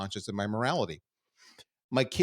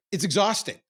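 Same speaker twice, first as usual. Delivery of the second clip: raised and louder, insisting; exhausting, emphatic; 9 semitones higher, 5 dB louder than usual. Real, not acted. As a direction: push the word out hard.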